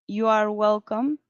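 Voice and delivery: You are welcome said slowly, every word apart; full form, slow. Shown as a demonstration. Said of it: "también se usa". (You are welcome lento y completo)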